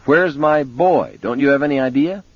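high desperate shout, speeding up. A narrator's reading. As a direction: plead loudly, rushing the end. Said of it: "Vic shouted into the phone".

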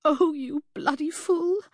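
high, slow, tearful affection; tearful fond scolding. A narrator's reading. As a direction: scold him lovingly through tears. Tearful acceptance of proposal.

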